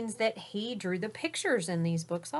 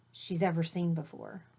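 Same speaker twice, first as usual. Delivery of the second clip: usual speaking voice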